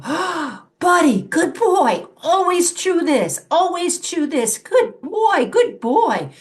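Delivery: the owner praising the dog with a toy, high, loud and slow. Shown as a demonstration. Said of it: "you go".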